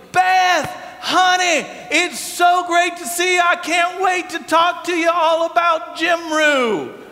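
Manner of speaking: high, loud hollered greeting; big drawn-out, high-pitched welcome; booming excited dad. Retold or imitated. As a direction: holler it like an overjoyed Southern dad. Dad greeting fiancée with monstrous hug.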